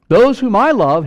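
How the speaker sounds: loud, high proclamation of the verse; God speaking. Acted. Preacher voicing God to the congregation, loud proclamation. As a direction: cry it out as God's own words. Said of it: "here's God speaking".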